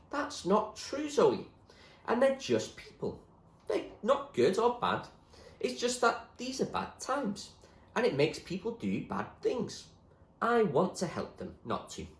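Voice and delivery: gentle William. Acted. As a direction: gently reassure her. William gently corrects Zoe.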